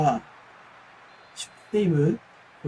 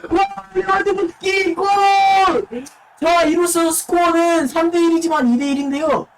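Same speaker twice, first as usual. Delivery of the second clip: esports caster; 8 semitones higher, 3 dB louder than usual. Acted. Goal call in live commentary.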